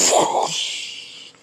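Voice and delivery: breathy whoosh of a sword slash; whoosh sound effect. Retold or imitated. Storyteller voicing a sword slash whoosh. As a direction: blow out a soft slash sound. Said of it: "that's basically what it sounded like".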